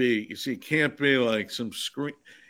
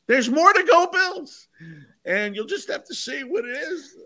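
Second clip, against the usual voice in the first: teasing. Real, not acted. Teasing the movie.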